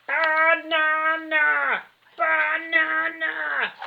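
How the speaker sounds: slow booming gorilla roar, stretched out. A narrator's reading. Giant gorilla roaring for bananas, booming and slow. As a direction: bellow it like a hungry giant ape. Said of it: "roared the giant gorilla".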